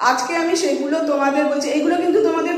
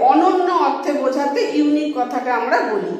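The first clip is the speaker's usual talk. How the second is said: normal talking voice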